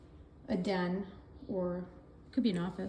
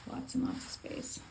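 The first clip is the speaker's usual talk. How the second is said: whispering; 7 dB quieter than usual. Real, not acted. Whispered aside about closet.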